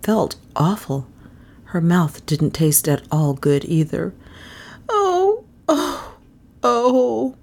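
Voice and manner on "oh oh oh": high, drawn-out moans; miserable. A narrator's reading. Melissa nursing a sore jaw, miserable. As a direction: moan each one in self-pity, starting high. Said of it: "said Melissa miserably".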